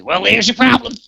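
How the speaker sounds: high, loud cartoon cowboy punchline; Yosemite Sam. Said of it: "And Yosemite Sam, he says".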